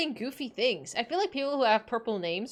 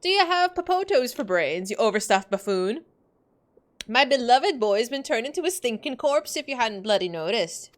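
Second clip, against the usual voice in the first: scolding Scottish mum, raised and loud; Scottish mother; 4 dB louder than usual. Acted. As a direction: tear into him in a brogue. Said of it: "I don't know where this accent came from".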